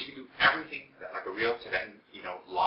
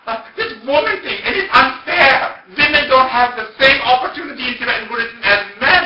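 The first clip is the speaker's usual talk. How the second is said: high, loud, drawn-out protest; very passionate; 18 dB louder than usual. Retold or imitated. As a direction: imitate her impassioned outcry at injustice. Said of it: "she was very passionate".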